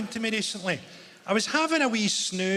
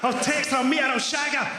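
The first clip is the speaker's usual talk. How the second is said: horny inner voice; 7 semitones higher than usual. Retold or imitated. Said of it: "you go".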